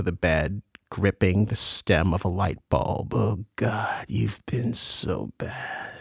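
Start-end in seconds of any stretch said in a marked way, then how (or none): 3.1-5.9 slow, exhaled whisper; breathy whisper. Acted. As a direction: breathe the words out slowly, barely voiced. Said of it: "He didn't really speak. More like his words were exhaled".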